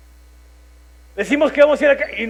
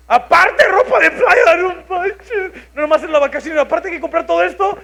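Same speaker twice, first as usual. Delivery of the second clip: high, loud, strained outburst at the extra cost; half-crying protest, voice cracking high; alarmed outcry; 8 semitones higher, 6 dB louder than usual. Retold or imitated. To his wife about buying beach clothes, a loud high alarmed outcry.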